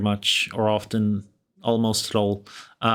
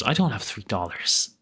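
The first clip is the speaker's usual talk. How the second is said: ordinary talk, nothing marked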